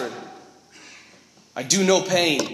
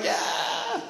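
mock whispered yeah; 7 dB quieter than usual. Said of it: "And I'm like".

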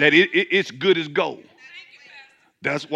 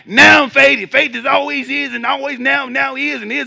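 mock preachy sing-song, higher; 7 semitones higher, 7 dB louder than usual. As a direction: parody the way it's taught. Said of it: "I know we've been taught like".

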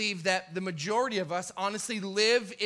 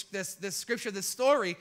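ordinary talk, nothing marked

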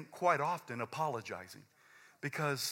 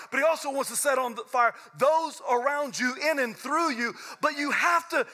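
loud, high, driving preacher voice climbing as it goes; preaching at full force; 10 semitones higher, 6 dB louder than usual. Acted. Pastor pressing the church about stifling the Spirit, building to a loud high peak.

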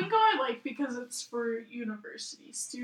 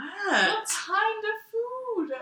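slow exasperated; 8 semitones higher, 5 dB louder, much slower than usual. Real, not acted. Spelling out question slowly.

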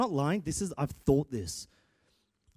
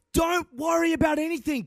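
loud, high voiced-God line; preaching shout; 12 semitones higher, 3 dB louder than usual. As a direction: proclaim it as God speaking. Voicing God to congregation.

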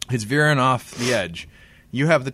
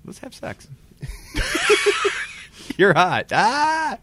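flirty voice; 14 semitones higher, 4 dB louder, slower than usual. Pretend. Playing Joan of Arc flirting.

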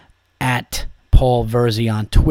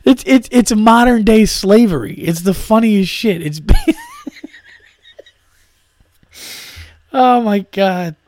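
laughing through words; 10 semitones higher, 3 dB louder, slower than usual. Real, not acted.

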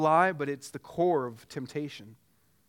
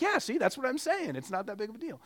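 smug excuse; 7 semitones higher than usual. Retold or imitated. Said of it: "And you're like".

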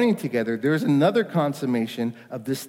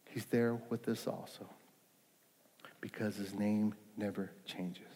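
softer, intimate preaching; hushed sermon; 4 semitones lower, 7 dB quieter than usual. Acted. Pastor softly assuring the congregation of God's presence, hushed. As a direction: draw the congregation in quietly.

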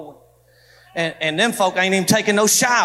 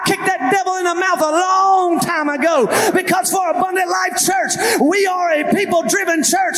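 preaching at full force; 7 semitones higher than usual. Acted. Pastor declaring against segregation to his church, full preaching shout. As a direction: drive it home to the congregation.

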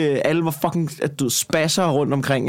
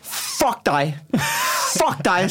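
mildly raised, snapping comeback; higher-pitched retort, not a full shout; retold angry yell; 5 semitones higher, much slower than usual. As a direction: replay snapping back at a heckler. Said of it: "Så råber jeg bare".

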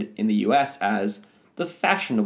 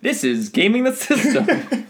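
announcer voice; 12 semitones higher, 3 dB louder than usual. Pretend. Podcast intro announcement.

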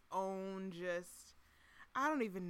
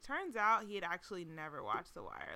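ordinary delivery

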